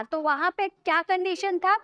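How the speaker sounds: normal talking voice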